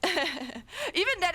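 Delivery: usual speaking voice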